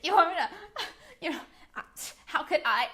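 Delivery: high, loud giggly protest; mock client giggling in disbelief. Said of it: "started giggling uncontrollably".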